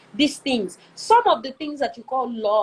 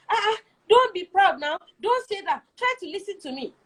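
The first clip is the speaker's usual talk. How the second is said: higher, tearful whining plea; whiny pleading; 6 semitones higher than usual. Retold or imitated. Wife pleading with proud husband, higher and tearful. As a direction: beg him to listen, a little weepy.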